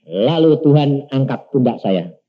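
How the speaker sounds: normal talking voice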